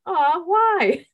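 put-on young camper asks the counselor why in a child's coo; sympathetic child. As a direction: coo like a caring little kid. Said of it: "And they're like".

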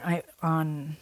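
usual speaking voice

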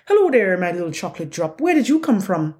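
mock Irish catcall; Irish accent. Workman calling out the van window at her, in a bad Irish accent. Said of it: "I can't do an Irish accent".

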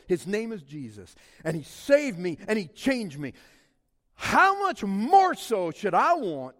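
loud, high, driving sermon voice; preaching at full force. Acted. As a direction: preach it out to the whole church.

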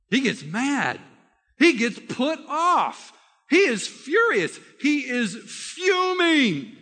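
each phrase climbs toward a fuming shout, slowing on the last; building anger. A narrator's reading. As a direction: pile the anger up word by word until it bursts. Preacher builds Naaman's anger.

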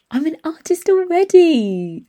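put-on high and loud, slowly insisting like a small child; proud little boy. Son corrects Santa in the grotto, proud and high. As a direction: imitate a four-year-old putting Santa right. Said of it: "like that".

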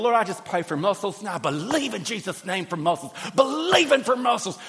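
high, loud hammed-up fervent prayer; gym-goer loudly believing for muscles; mock fervent prayer. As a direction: build into a comic, zealous plea. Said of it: "in the middle of the gym going".

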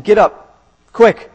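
brisk wake-up call; urgent command. A narrator's reading. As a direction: rouse someone in a hurry. Angel urgently waking sleeping Peter.

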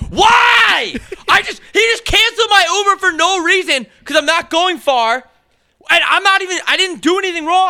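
retelling being refused a ride, cracking exasperated shout; near-crying shout. Retold or imitated. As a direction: shout it like you're on the verge of tears. Retold shouting at the driver.

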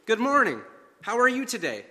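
a lightly voiced cheerful passerby; cheerful greeting. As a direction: call out a cheery good morning. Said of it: "said to me cheerfully".

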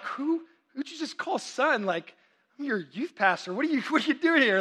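high-pitched, incredulous comic retort; mock indignation. Youth pastor to cheeky teenager, baffled mock indignation. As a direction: play up being taken aback.

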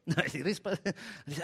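ordinary talk, nothing marked

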